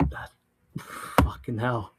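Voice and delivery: normal talking voice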